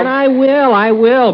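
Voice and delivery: high, quick false promise; soothing him with a hollow promise; overeager reassurance. Acted. Conman hastily reassuring.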